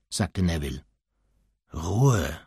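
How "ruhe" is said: a low, hoarse single word. Acted. Lupin wakes and silences the children, hoarse. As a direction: rasp it out, sudden and hoarse. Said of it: "sagte plötzlich eine heisere Stimme".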